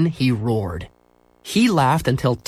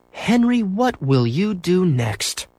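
sighing mother; 7 semitones higher, slower than usual. Acted. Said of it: "sighed his mother".

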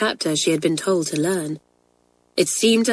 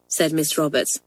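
plain reading voice

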